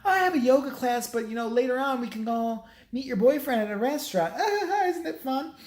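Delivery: hammed-up perky mom making plans; imitated chirpy mom. Mom makes plans with her daughter, mock chirpy gushing. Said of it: "And she's like".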